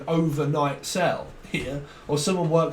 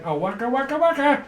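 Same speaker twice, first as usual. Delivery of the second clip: waka voice; 8 semitones higher, faster than usual. Retold or imitated. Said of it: "It's like".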